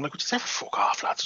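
unvoiced, fast hushed dismissal; muttered aside. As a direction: whisper it under your breath. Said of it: "go on about that nonsense".

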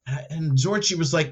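usual speaking voice

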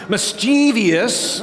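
slow Midwestern Mischievous, mis-TEE-vee-us; Midwestern pronunciation. Shown as a demonstration. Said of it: "That's definitely Midwestern".

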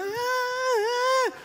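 high stadium yell; excited crowd cheer. Retold or imitated. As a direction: cheer like the whole stadium just scored. Fans cheering after first down.

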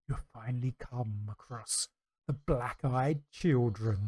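slow, low villain menace; slow theatrical villain menace. Pretend. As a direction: loom over them slowly. Spooky story reading climax.

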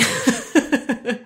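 laughing at herself; a run of short chuckles; amused laugh. Real, not acted. Coach confessing she cleans when mad, amused laugh.

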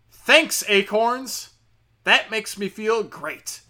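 loud, high-pitched sarcastic gratitude; sarcastic thanks. Acted. Investor thanking app for eighty cents, sarcastic. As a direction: punch it out with bitter mock gratitude.

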